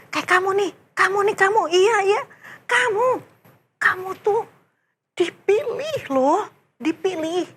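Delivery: high, insistent pointing out; emphatic. Acted. Preacher tells the child he is chosen, high and insistent. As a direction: tell the child eagerly. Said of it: "emphatic address to child".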